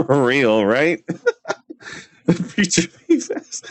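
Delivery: talking through real laughter; laughing. Real, not acted. Streamer cracking up at "Preacher Beavis", laughing. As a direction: let the giggles break up the words.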